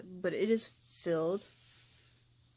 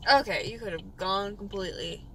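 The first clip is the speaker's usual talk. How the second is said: ordinary talk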